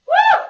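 a high, loud cheering whoop; excited whoop. Real, not acted. Instructor whooping at the class mid-set, loud and high.

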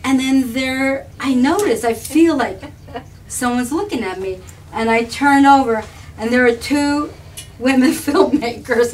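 loud, high, laughing storytelling; laughing retelling. Real, not acted. Telling friends about crying at a red light, laughing loudly. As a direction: tell it to your friend while you crack up.

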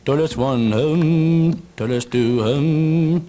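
low, slow mystic hums over cards; mystical murmuring. Acted. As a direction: read the cards like a fake psychic. Mystic card reading.

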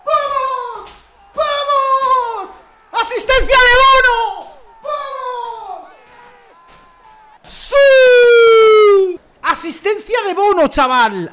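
gritos muy agudos y potentes de gol; desgañítate celebrando; celebración eufórica. Real, not acted. Celebra el gol del portero. (grito eufórico por el gol de Bono)

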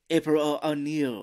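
slow, loud, hammed-up Japanese accent; bad Japanese accent. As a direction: imitate a villain's fake Japanese accent. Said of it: "Japanese accent".

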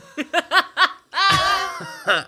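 bursting out laughing, loud and high; a big unguarded cackle. Real, not acted. Laughs at own drunk story.

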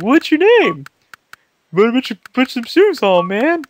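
high, loud Southern comic voice; loud twangy stand-up bit; Bill Engvall impression. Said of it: "Bill Engvall reference".

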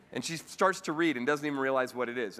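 ordinary delivery

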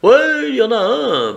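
smug and in control, lifted voice. Acted.

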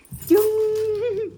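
long, high-pitched squeal; playful squeal. Real, not acted. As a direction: draw out one loud, high squeak. Playing catch with the dog.

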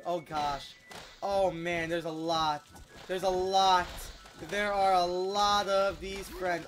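usual speaking voice

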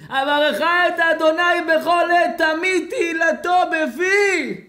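high, loud, drawn-out wailed verse; hammed-up weeping chant; mock tearful wail. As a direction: bawl the verse slowly, as if crying. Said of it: "על מה אתה בוכה".

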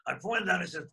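ordinary talk, nothing marked

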